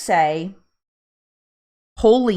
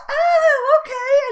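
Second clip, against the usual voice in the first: very high, imitated little-girl voice; little girl's realization; 5 dB louder, much slower than usual. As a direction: mimic a child suddenly getting it. Little daughter finally understands the words.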